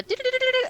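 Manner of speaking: high-pitched rapid "do-do-do" trill; cash register noise. Acted. Game master mimicking the register slamming, high trill. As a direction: imitate a cash register rattling shut.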